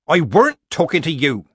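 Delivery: loud, high, cross retort; cutting rebuke. A narrator's reading. Nanny silencing the interrupting committee woman, cross.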